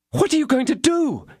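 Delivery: high alarmed cry; frightened cry. A narrator's reading. Wife stops D'Artagnan rushing out, high frightened cry. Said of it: "cried Madame Bonancieux".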